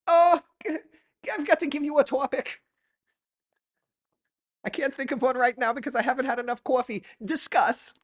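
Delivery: flustered sketch hostess tossing out a topic; Coffee Talk hostess. Acted.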